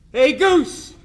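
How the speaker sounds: a retold shout across the clubhouse, pitched high; calling out loud. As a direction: holler to a teammate across the room. Munson calls across the clubhouse.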